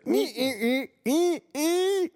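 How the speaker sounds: very high squeaky beeping horn noise; imitated horn beep. Friend retelling being backed into, mimicking his horn. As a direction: squeal like a tiny tooting horn. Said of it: "tuta med min fon".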